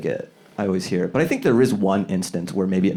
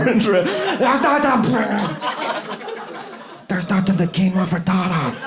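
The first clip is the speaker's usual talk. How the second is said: high strained mock cry; halting, hammed-up call; injured man's warning; 10 semitones higher than usual. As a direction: keep sounding the alarm after the crash. Injured man still yelling the warning.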